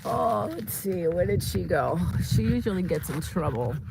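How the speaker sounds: normal talking voice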